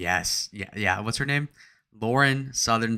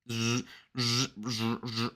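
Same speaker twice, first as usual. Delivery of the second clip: razor buzz imitation; 7 dB quieter, slower than usual.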